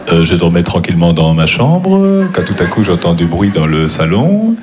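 deadpan storyteller stretching each last word upward; halting delivery with long rising tails on final words; stand-up comedian imitation. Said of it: "je vais faire des imitations".

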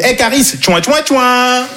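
high-pitched teasing chant; mock fan call, last word drawn out high; heckling fan. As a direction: imitate a street joker's catchphrase. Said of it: "quelqu'un me fait la blague dans la rue en mode".

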